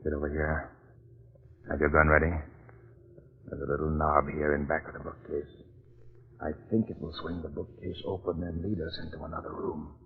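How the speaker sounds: low, tense, hushed instructions; hushed whisper. Acted. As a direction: speak softly so no one hears. Sneaking toward a hidden room.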